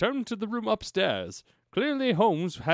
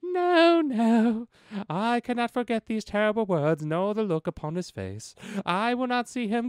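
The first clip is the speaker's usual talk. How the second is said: posh English outburst; 5 semitones higher, 5 dB louder than usual. Acted. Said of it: "attempt an English accent".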